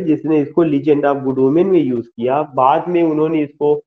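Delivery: ordinary talk, nothing marked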